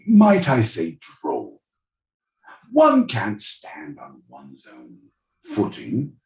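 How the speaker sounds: mock posh British accent, airy and breathy in places; hammed-up upper-class British accent. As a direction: lord it over PBS in a snooty British accent. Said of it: "this next question is for British".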